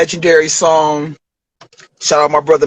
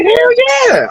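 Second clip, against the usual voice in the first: much higher, loud thrilled yes; eager yes; 3 dB louder than usual. Retold or imitated. Rapper accepting a video offer, thrilled. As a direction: jump at the offer. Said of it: "He's like, he's like".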